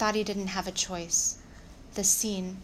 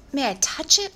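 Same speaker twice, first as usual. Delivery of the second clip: high, loud, eager asking; gushing request; 7 semitones higher, 9 dB louder than usual. Acted. Claudine asking to touch the baby donkey, gushing. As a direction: gush with delight, pitched up.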